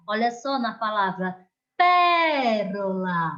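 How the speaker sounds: normal talking voice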